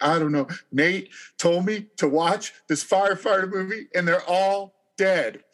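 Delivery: high, choked-up mock sobbing, words pitched well up; retold bawling. Husband explaining to his wife why he's crying, high mock sobbing. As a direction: whimper it out like a grown man caught crying at a movie. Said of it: "You know, I am bawling".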